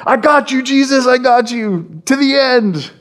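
lightly voiced Peter, high and eager; a hint of a boastful disciple swearing loyalty; bold Peter. Preacher voices Peter promising Jesus loyalty, eager and high.

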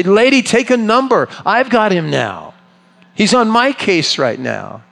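high, insistent "wait your turn" voice; pushy Jairus. Pretend. Jairus telling the woman to take a number, pushy and high. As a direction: brush the woman off, it's my turn now. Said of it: "If I'd have been Jairus, I'd say".

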